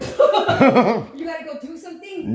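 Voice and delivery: slow, loud, laughing mock question; teasing his lame excuse through laughter; laughing disbelief. Real, not acted. Mocking a friend's excuse, a slow laughing repeat.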